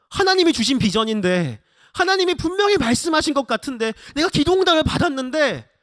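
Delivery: high-pitched bewildered pleading; anguished protest. Acted. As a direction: protest to God that it was promised. Bewildered believer protesting to God.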